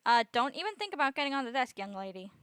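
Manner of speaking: loud, sharp warning to a pet; stern scolding. Real, not acted. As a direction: scold the cat off the desk.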